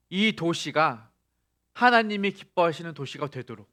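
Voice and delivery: 크게 높여 외치는 설교; 회중을 향해 목소리를 끌어올려 선포하듯. Acted. (교인들에게 꿈을 외치는 힘찬 설교)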